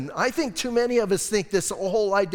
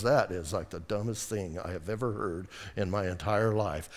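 a scoffer crushing your idea, low and softer; dismissive put-down; 9 semitones lower, 8 dB quieter than usual. Retold or imitated. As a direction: drop low and sneer it out. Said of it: "somebody goes".

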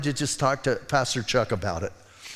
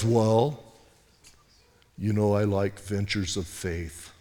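lightly voiced Pastor Chuck; 8 semitones lower, 6 dB quieter, slower than usual. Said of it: "He goes".

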